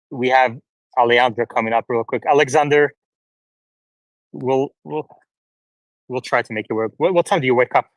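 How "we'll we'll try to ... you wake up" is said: ordinary delivery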